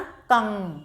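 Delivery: कंग spoken slowly with nasal ng; nasal syllable demo. Shown as a demonstration. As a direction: say कंग with the ं sounded through the nose. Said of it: "नाक से उच्चारण करेंगे".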